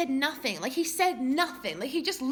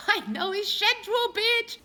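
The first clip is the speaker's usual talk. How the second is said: laughing; 7 semitones higher, 4 dB louder, slower than usual. Real, not acted. Laughing at own stalker confession.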